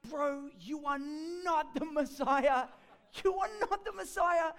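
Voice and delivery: high, loud, slow disbelief with a laugh; incredulous mockery. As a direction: scoff at your big brother. Brother mocks Jesus's claim.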